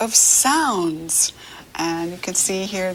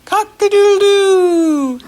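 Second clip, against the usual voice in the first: high loud crowing call; loud rooster crow for a child; 12 semitones higher, 8 dB louder than usual. Shown as a demonstration. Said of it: "And the rooster says".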